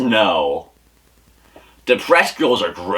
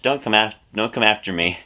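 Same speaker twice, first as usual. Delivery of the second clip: ordinary delivery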